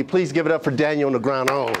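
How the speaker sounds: ordinary talk, nothing marked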